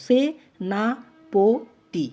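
সেনাপতি very slow, se, na, po, ti apart; syllable by syllable. Shown as a demonstration. Teacher showing each one ends in vowel, syllable by syllable. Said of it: "প্রত্যেকটাই দেখো".

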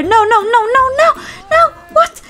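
desperate cries as the fox strikes; panicked no. Real, not acted. Fox attacking her chickens.

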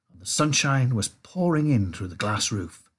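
ordinary delivery, nothing marked

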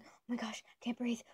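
whispered, gasping for air as a joke; hushed pretend gasp, then drop the joke; playful whisper with a gasp; mock breathless whisper. Jokes about being overwhelmed.

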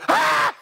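high loud scared cry; hammed-up fright; mock panicked yelp. Preacher joking Jesus fled, high mock yelp.

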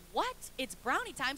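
incredulous questioning echo; puzzled mom. Retold or imitated. Mom to toddler demanding brownies early, puzzled disbelief. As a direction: repeat it back in disbelief, without the excitement.

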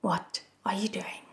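quiet, mildly worried displeasure; softly, with restrained concern; displeased and worried. A narrator's reading. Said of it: "vaguely displeased and worried".

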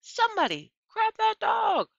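high, loud panicked scream; screaming manager. A narrator's reading. Store manager screaming at his staff to grab the dog. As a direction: shriek it at the staff. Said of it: "The manager screamed".

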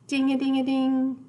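playful bell sound; bell imitation. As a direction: jingle it like bells on a string. Imitating bells in the story.

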